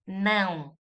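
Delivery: "Não" with a nasal diphthong; nasal tilde sound. Shown as a demonstration. Teacher confirming the tilde, nasal "Não". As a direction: let "Não" ring in the nose. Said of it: "is with the tilde".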